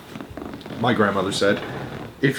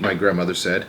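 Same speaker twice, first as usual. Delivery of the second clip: ordinary delivery, nothing marked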